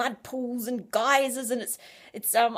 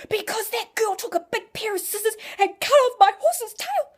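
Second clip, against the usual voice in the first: indignant complaint; 11 semitones higher, 7 dB louder than usual. Retold or imitated. Complaining to God about horse.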